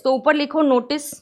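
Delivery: usual speaking voice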